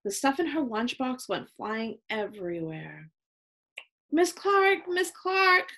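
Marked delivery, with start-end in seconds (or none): 4.1-5.7 high, louder whiny call; wailing. A narrator's reading. Chloe calling Miss Clark for help, wailing. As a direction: wail for the teacher. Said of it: "Chloe wailed".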